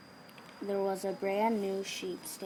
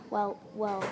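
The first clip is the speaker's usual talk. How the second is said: a louder flustered sputter; lost for words, sputtering; 5 dB louder than usual. A narrator's reading. Ernie caught off guard by Willie, sputtering. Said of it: "sputtered Ernie".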